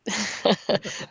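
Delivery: easy run of chuckles; real chuckle. Real, not acted. Host hearing the cats are wild, amused chuckle.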